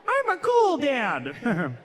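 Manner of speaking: high, slow proud dad voice; mock cool dad. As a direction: drag it out like a smug dad. Dad voice boasting.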